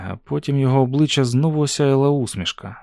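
plain reading voice

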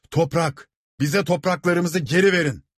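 high-pitched crowd cry; insistent demand. A narrator's reading. Rebels answer the steward, crying out. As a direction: shout back, demanding what is yours.

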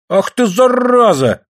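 high, drawn-out abusive cry; scolding yell. A narrator's reading. Ksenia attacks the man, scolding yell. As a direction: yell the insult with relish. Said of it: "заорала Ксения".

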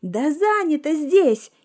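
slow, high nervous yell; nervous shout. A narrator's reading. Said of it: "нервно выкрикнула она".

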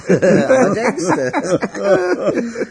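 slow, high words through laughter; laughing. Acted. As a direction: drag it out while cracking up. Laughing at his own fantasy.

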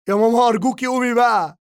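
high, loud, fast sobbing plea; crying scream. Acted. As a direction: wail it through tears, loud and high. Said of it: "البكاء المصحوب بالصراخ".

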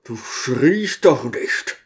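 usual speaking voice

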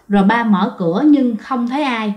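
ordinary delivery, nothing marked

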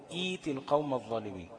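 "ايت القوم الظالمين" starting on a long ee; recited, with smooth eet in "ايت"; long i replacing hamza. Shown as a demonstration. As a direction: stretch the first vowel of "ايت", no glottal stop. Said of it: "يبقى أقول".

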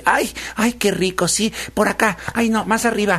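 high-pitched, fussy piglet being massaged; demanding pampered piglet. Acted. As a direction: squeal out contradictory orders mid-massage. (cerdito mimado y exigente, voz muy aguda)

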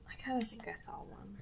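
hushed, half-embarrassed whisper. Real, not acted. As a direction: whisper it like a guilty secret. Sheepish admission of a sighting.

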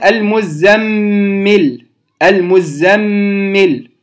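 المزمل with the doubled M held slowly; ghunna demo. Shown as a demonstration. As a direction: linger on the MM of المزمل. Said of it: "الميم مشددة أيضًا تنطق بالغنة". (المزمل بغنة ممدودة)

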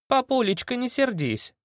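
high, softening plea; coaxing. Acted. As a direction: wheedle sweetly. Girl coaxes pinched father.

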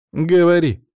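loud, high-pitched angry order; fierce woman's growled demand; tigress snarl. A narrator's reading. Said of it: "Софья зарычала на Павла, словно тигрица".

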